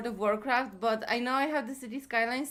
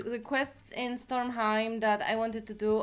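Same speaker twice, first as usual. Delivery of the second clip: ordinary talk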